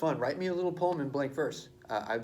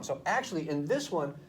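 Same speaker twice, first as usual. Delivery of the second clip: ordinary talk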